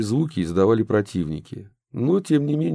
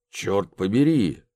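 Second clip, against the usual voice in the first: slow menacing curse; sinister voice; slower than usual. Acted. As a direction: growl it like a villain. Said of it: "раздался сзади зловещий голос".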